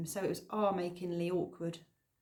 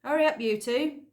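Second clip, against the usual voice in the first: louder, higher call from a distance; 5 semitones higher, 8 dB louder than usual. A narrator's reading. Farmer calling from the driver's seat, loud. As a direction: call across to hurry them. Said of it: "called from the driver's seat".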